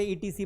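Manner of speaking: "ईटीसी" said as three letters, ee-tee-see; letter-by-letter misreading. Shown as a demonstration. Teacher showing how students read the abbreviation, letter-by-letter misreading. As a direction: spell out "ईटीसी". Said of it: "ये ईटीसी नहीं है".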